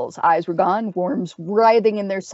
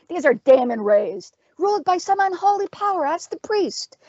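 high, exasperated witch voice; urgent cry; 10 semitones higher than usual. A narrator's reading. Witch explaining the risen dead to the fighter, crying out. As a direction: explain in a pitched-up, impatient cry. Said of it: "Terma cried".

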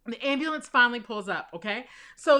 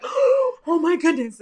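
shocked, tearful mom; 6 semitones higher, 6 dB louder, much slower than usual. Retold or imitated. Mom sees the injury, hands over mouth.

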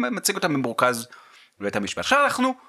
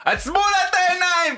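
exclaimed; 14 semitones higher than usual. Retold or imitated. Mock exclamation in retold scene.

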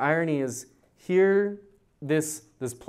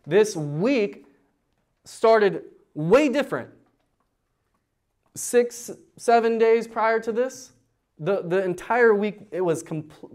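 loud, high, fervent preaching build; preaching at full force; 7 semitones higher, 5 dB louder than usual. Acted. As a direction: drive the message home with fire.

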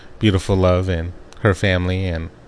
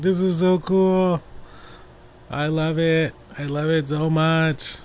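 retold gushing excitement; 8 semitones higher, 3 dB quieter, slower than usual. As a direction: gush slowly in a high voice. Retelling excitement at a Christmas gift.

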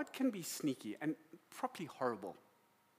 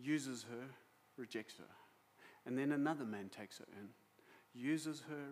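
subdued, lowered voice heavy with compassion; sombre sorrow; 3 semitones lower, 4 dB quieter than usual. Real, not acted. Preacher telling of a woman repeatedly rejected, sombre. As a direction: let each rejection fall away softly at the end.

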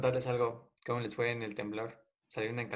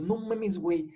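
alarmed shock; 7 semitones higher, 3 dB louder, slower than usual. Retold or imitated. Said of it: "Y yo".

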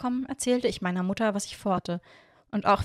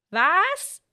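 high, louder, sharply rising what; puzzled question; 10 semitones higher, 6 dB louder than usual. A narrator's reading. Hiro confused below the window, puzzled high question. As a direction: ask it baffled, pitch shooting up. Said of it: "fragte er mit runzliger Stirn".

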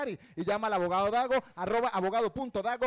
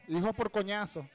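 usual speaking voice

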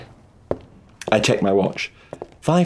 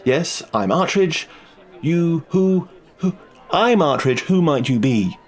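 higher-pitched, tongue-tied and floundering; flustered; 7 semitones higher, slower than usual. A narrator's reading. Detective failing to cope before a striking woman, flustered. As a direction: stumble over your own name, pitch jumping. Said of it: "That might not sound like a man coping admirably".

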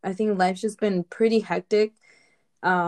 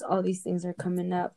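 ordinary delivery

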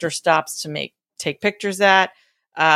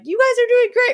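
cheery cheerleader voice; 4 dB louder than usual. Retold or imitated. Cheering strangers quoted.

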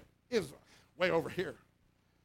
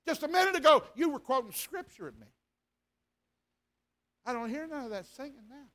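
taunting devil; 4 semitones higher than usual. Retold or imitated. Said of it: "The devil said to me".

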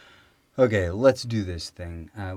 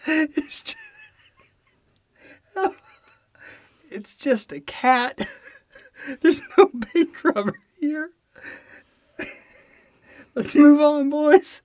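high, loud, squeaking through laughter; giggle-choked cop line; laughing through words; 9 dB louder, slower than usual. Real, not acted. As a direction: try to get the punchline out while cracking up.

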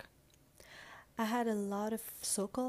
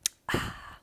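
dramatic sigh; 6 dB louder than usual. Acted. Dramatic pause before punchline.

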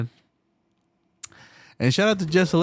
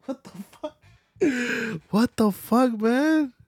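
drawn-out, broken, near-crying what the fuck; a real gasp of disbelief, slow and high; stunned disbelief; 9 semitones higher, much slower than usual. Real, not acted. Incredulous reaction to news.

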